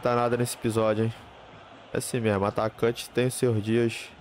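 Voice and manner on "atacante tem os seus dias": ordinary delivery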